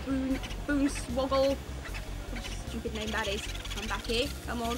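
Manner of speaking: ordinary talk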